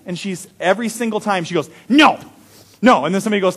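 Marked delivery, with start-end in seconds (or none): 1.9-3.0 put-on high loud toddler No, no; toddler's refusal. Little Maddie refusing help with her coat, high and loud. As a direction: squeal no like a stubborn two-year-old.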